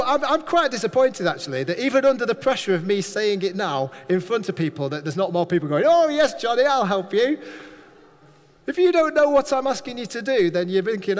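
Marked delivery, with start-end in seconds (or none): 5.9-7.4 high, loud, eager "yes" to the preacher; eager volunteer. Pretend. Congregation member eagerly volunteering for Johnny, high and loud. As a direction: jump at the chance to help.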